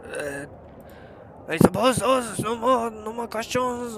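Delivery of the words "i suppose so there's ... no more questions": raised, louder quest giver voice; a different, higher character voice; higher NPC voice. Acted.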